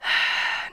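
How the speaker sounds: soft breathy sigh of worry; weary sigh. A narrator's reading. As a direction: let out a long tired sigh. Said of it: "He sighed".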